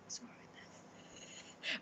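very soft, slow whisper. Real, not acted. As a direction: whisper it slowly to yourself. Repeats the remark in a whisper.

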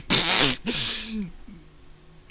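loud, helpless laugh at the silly name; laughing out loud at the story; burst of laughter. Real, not acted. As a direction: crack up for real at the words. Breaks up at "hokey pokey.

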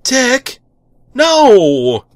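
high, loud desperate yell; panicked shout. Acted. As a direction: shout to stop him. Arthur tries to stop hero.

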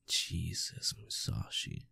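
ordinary delivery

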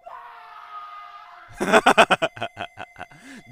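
sudden high shrieking laugh; real laughing fit. Real, not acted. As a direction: burst out laughing at the scream. Bursts out at sudden scream sound.

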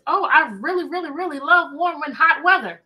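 ordinary talk

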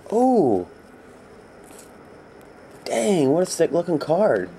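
sudden high, loud burst of delight; excited shout. Real, not acted. Seller sees a rare card for a buyer, excited shout. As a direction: yelp at the card like you can't believe it. Big hit pulled on camera.

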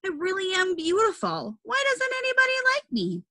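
high, plaintive lonely fish; sad pleading. Acted. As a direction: ask it with hurt and longing.

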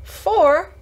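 high-pitched incredulous echo; astonished cry. A narrator's reading. Martha amazed at Mary's question, astonished cry. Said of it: "cried out Martha".

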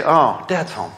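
low-key, sinking disappointment; deflated dread. Acted. Family seeing dad come home, deflated. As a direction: let the heart drop on the greeting.

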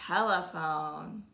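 ordinary delivery, nothing marked

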